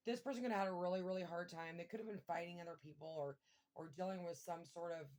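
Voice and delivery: normal talking voice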